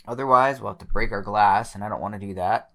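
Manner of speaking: normal talking voice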